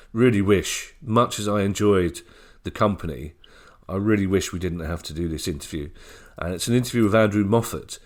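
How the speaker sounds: normal talking voice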